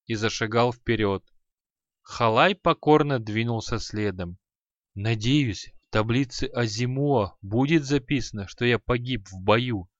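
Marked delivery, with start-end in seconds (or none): none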